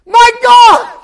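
high, held scream; agonized cry. Acted. Jesus on the cross, agonized scream. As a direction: cry to heaven. Preacher voicing crucifixion cry.

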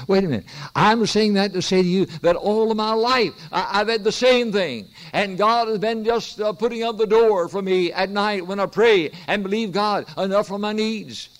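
impassioned sermon delivery; fervent preaching. Acted. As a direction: preach it out to the congregation. Breaks off story to preach.

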